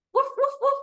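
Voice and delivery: high, loud imitated barks; dog barking. Shown as a demonstration. Teacher voicing the illustrated dog, high loud woofs. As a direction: yap like the dog in the picture. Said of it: "Kita bisa suarain ada, ada ilustrasi anjingnya".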